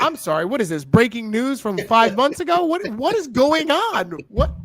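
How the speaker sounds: rising, incredulous put-down of stale news; mock exasperation. Mocking the stale news to his friend, rising exasperated disbelief. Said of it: "It's like".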